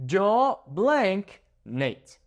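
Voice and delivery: drawn-out fill-in-the-blank reading; slow blank prompt. Shown as a demonstration. Teacher reading a blank slowly to students, drawn out. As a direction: read the gap slowly, leaving room to guess.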